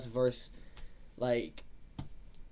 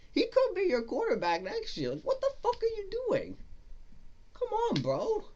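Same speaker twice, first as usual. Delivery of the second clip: exasperated high-pitched cry. Real, not acted. Fan pleading with his favorite player, high exasperated cry. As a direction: voice jumps way up in disbelief.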